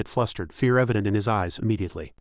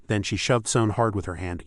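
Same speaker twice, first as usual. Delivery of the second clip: ordinary delivery, nothing marked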